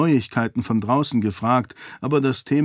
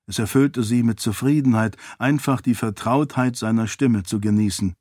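usual speaking voice

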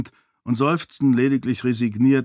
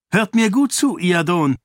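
a much higher, louder, cutting command; sharp, cold warning; 7 semitones higher, 5 dB louder than usual. A narrator's reading. Sarene warns the king with a sharp, cold voice. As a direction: cut him off coldly and sharply. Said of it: "mit scharfer, kalter Stimme".

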